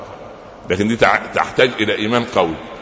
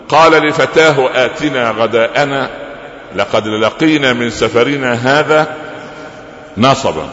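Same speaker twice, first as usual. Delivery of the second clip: slow Quranic recitation; 3 semitones higher, slower than usual. Acted. Recites Quran verse slowly.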